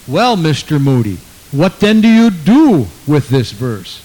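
lightly voiced pressing questioner, loud; insistent stranger. Stranger pressing the evangelist for an answer, lightly acted and loud. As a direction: press the question loudly, drawing it out.

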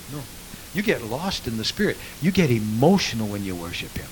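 usual speaking voice

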